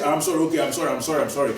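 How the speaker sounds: careless, hurried string of apologies; insincere apology. Shown as a demonstration. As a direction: reel off sorries without meaning them. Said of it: "you are not even in tune".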